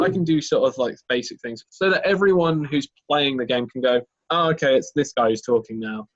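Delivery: ordinary talk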